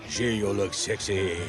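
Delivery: teasing imitation of a French flirt; mock French accent. Friend mimicking the Frenchman's flirting, mock French accent. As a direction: put on a French accent to tease. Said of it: "with a French accent".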